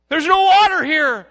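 high, loud whiny complaint; mock complaint. Mimicking Israelites griping at Moses, high and loud. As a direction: whine it like a spoiled grumbler. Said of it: "they get to a place and go".

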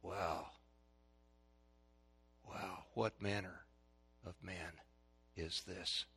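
very soft, low and slow; hushed, wondering voice; awed disciples' thought. Acted. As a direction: marvel under your breath, with long pauses. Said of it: "the disciples are thinking".

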